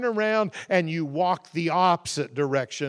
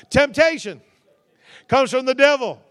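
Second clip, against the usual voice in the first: louder, higher call-and-response; corrected line, called out; 6 semitones higher, 9 dB louder than usual. Shown as a demonstration. Preacher corrects the congregation, calling the line out louder. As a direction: belt out the correction. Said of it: "No, not Washington".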